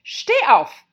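high, piercing shouted command; shrill and loud. Acted. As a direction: shriek it as an imperious queen. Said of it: "mit schriller, lauter Stimme".

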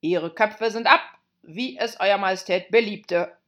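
loud soldierly report; shouted reply. Acted. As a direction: shout it back to the queen. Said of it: "riefen die Soldaten zur Antwort".

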